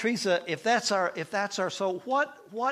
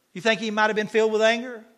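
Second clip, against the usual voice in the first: ordinary talk, nothing marked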